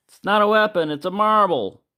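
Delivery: hammed-up innocent; comic high protest. His comic reply to police.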